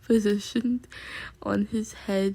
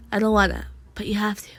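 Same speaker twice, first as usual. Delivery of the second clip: whispered; 5 semitones lower, much faster than usual. Pretend. Arguing with herself, whisper.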